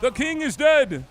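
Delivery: high, loud, slow victory call; triumphant proclamation. Pretend. Player announcing the fallen king, loud triumphant proclamation. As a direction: proclaim it grandly, loud and drawn out.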